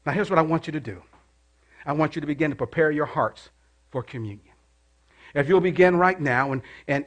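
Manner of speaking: soft, low guiding voice; gentle communion guidance. Acted. Pastor preparing church for communion, soft and gentle. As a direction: lead them gently into communion.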